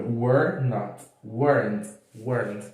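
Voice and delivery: "Were not" then "weren't" as /woɾɐnt/, falling; contraction demo. Shown as a demonstration. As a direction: show how "Were not" shrinks to "weren't". ("Were not" virando "weren't", tom descendente)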